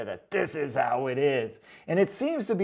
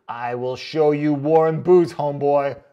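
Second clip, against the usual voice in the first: mock tough-guy read; 9 dB louder than usual. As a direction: ham up the commenter's threat. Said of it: "I gotta go back and read this one".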